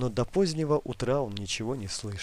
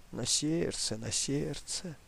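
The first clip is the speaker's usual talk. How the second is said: softer, meek agreement; obedient; 2 semitones higher, 5 dB quieter, slower than usual. Acted. Grandmother meekly agreeing with her grandson. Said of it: "послушно согласилась".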